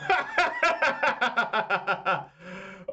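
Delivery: big disbelieving laugh, high. Real, not acted. Streamer laughing at the clean sweep on stream. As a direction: crack up at the play.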